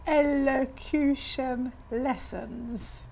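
very slow, drawn-out echo; mock elocution. Mother repeating her daughter's new plan, slow mock-refined echo. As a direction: stretch each word in mock elocution.

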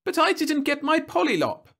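put-on high, loud child complaint; high-pitched childish grievance; protesting little girl. A narrator's reading. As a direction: object like a thwarted kid. Girl protesting to her mother.

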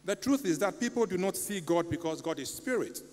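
usual speaking voice

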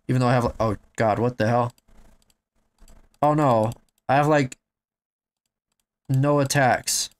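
usual speaking voice

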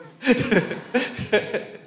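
bright burst of laughter, high. Real, not acted. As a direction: laugh out loud after the story. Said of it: "laughs after the quote".

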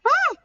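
sudden high-pitched yelp. Real, not acted. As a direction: yelp in surprise.

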